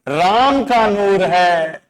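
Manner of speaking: drawn-out, slow, lingering on each word; slow poetic recital; slow mnemonic recital. Shown as a demonstration. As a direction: recite it slowly, like a line of verse. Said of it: "क्या बात बोली है".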